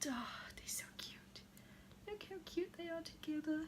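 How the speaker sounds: breathy whispered gushing. Real, not acted. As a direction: whisper it, melting over them. Gushing over doll couple.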